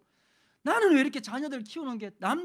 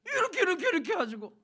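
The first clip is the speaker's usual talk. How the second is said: high, breezy mother brushing it off; 어머니 흉내; 9 semitones higher, 4 dB louder than usual. Retold or imitated. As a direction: toss it off like an old mom who cooks by feel. (대충 설명하는 어머니 흉내)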